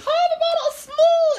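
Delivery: very high, loud little-creature voice; Xavier. Acted. Co-host voices his tiny companion greeting him, high squeaky voice. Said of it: "He's like".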